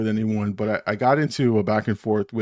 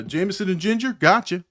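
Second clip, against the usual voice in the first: higher-pitched order check; lightly voiced bartender; 8 semitones higher than usual. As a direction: confirm a regular's drink, bartender-style. Voicing bartender taking an order.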